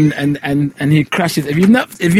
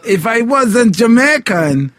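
high, slow Jamaican impression; Jamaican accent; 8 semitones higher, slower than usual. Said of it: "Jamaicans are like".

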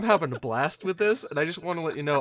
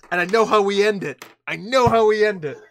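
excited; 4 semitones higher, 8 dB louder than usual. Real, not acted. Excited idea.

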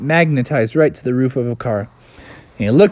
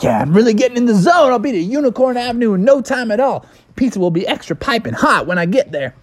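fast, higher-pitched excited driver; pumped-up Bubby; 10 semitones higher, faster than usual. Acted. Bubby speeding to Unicorn Avenue, fast and pumped up. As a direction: race through it, getting in the zone.

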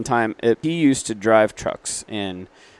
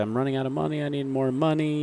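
whiny plea; 5 semitones higher, 5 dB quieter than usual. Retold or imitated. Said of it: "He's like".

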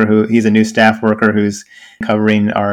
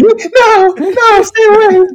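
high-pitched mock terrified wail; panicked refusal; 4 dB louder, slower than usual. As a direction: shriek as if recoiling, played for laughs. Imagined girl recoils from him.

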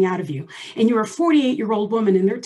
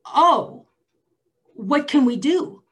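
higher and louder, slow; stunned fear; 5 semitones higher, 5 dB louder, much slower than usual. Retold or imitated. Patient begging the doctor for options, shaken. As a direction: grab for hope in shock. Hearing she has three months.

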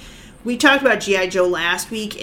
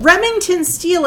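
excited recognition; 9 semitones higher than usual. Real, not acted. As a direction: exclaim the name in delight. Excited confirmation of name.

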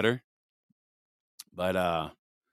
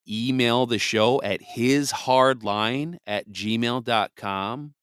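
slow and clear; 4 semitones higher, 3 dB louder, much slower than usual. Real, not acted. Spells out the show's email.

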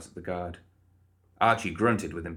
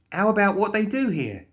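cheery Molly voice; 12 semitones higher, 5 dB louder than usual. Acted. Molly questioning guard.

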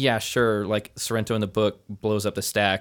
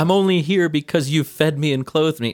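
mocking; 6 semitones higher, 5 dB louder than usual. Mocking Wade's line to aunt.

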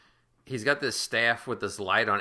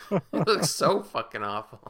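laughing, louder and slowed, at how awful it looks; laughing disbelief; 6 semitones higher, 5 dB louder than usual. Real, not acted. Friend cracking up over bad movie effects, laughing.

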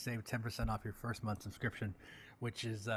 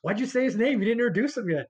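laughing; 10 semitones higher, 8 dB louder than usual. Real, not acted. Host laughs at early reveal.

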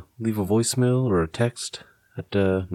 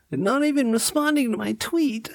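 whitey voice. Acted. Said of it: "had to put whitey voice into it".

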